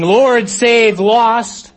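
high, loud holler to someone far off; yelled plea. Acted. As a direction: yell it urgently over the storm. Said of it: "has yelled back to Jesus saying".

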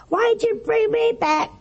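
high, loud, indignant mock protest; mad. Preacher voicing revived patient confronting his doctor, mad. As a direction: act out an outraged complaint. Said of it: "And he was so mad".

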